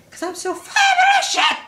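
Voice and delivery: very high, loud fed-up scream; furious shriek. Pretend. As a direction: scream it at the top of your voice. Voicing angry character.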